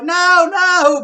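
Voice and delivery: very high, very loud scream; a desperate shriek; crying out. A narrator's reading. George and Missus Cranky scream at Grandma to stop. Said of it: "the other two cried".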